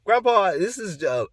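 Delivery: high, loud stumbling acted explanation; nervous. Grandson explaining his bit to grandpa, acted nervousness. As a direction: start high and falter into a stammer. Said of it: "I was kinda nervous".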